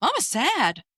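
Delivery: put-on high little-girl voice, worried; toddler voice. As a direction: imitate a small daughter asking her mother why she is sad. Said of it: "She goes".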